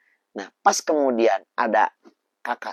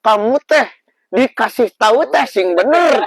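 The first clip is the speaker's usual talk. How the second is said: scolding mother; 10 semitones higher, 9 dB louder, slower than usual. Retold or imitated. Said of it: "Saya kan yang ngomong".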